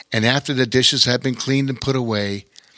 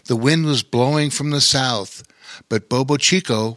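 ordinary delivery, nothing marked

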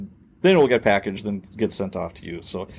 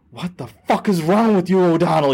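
sharp, higher, louder yell at a rookie; angry yell; 7 semitones higher, 3 dB louder than usual. Retold or imitated. Lieutenant yelling at the rookie officer, angry shout. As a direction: chew out the new officer. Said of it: "and he's like".

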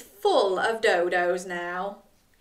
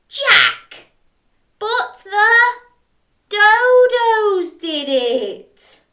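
high, louder, very slow; loud whiny protest; 11 semitones higher, 9 dB louder, much slower than usual. Acted. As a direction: insist at full volume. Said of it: "worse at the cafe".

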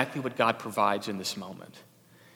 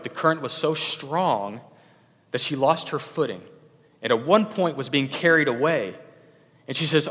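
ordinary delivery

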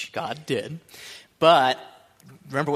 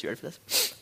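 whispered, teasing the crowd; 7 dB quieter than usual. Shown as a demonstration. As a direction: lean in and whisper it.

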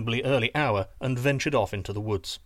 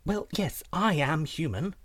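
higher-pitched innocent princess; 4 semitones higher than usual. Acted. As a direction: answer lightly in a high princess voice. Princess answers the suspicious giant.

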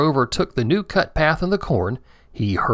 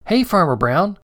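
put-on high, small child's voice; baby triceratops greeting the farmer, small voice; 6 semitones higher than usual. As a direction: greet him like a tiny creature. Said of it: "said a small voice".

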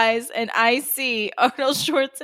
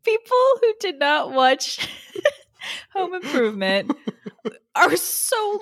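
laughing; 7 semitones higher than usual. Real, not acted. Laughing, high.

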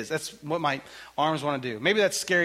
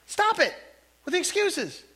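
exasperated God; 4 dB louder than usual. Retold or imitated. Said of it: "you can almost hear that and God".

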